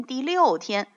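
ordinary delivery

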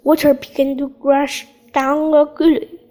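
usual speaking voice

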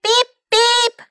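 high-pitched car horn beeps; high, playful honking call; cried horn imitation. A narrator's reading. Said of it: "cried Carmen".

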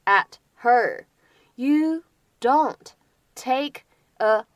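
very slow, a pause between words; slow word-by-word model. Shown as a demonstration. Teacher breaking the dialogue down for students, slow word-by-word. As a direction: read it out one word at a time for learners.

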